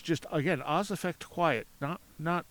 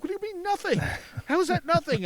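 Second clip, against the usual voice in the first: incredulous friend; 4 dB louder than usual. Retold or imitated. Friend disbelieves, voiced in story.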